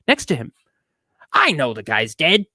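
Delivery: usual speaking voice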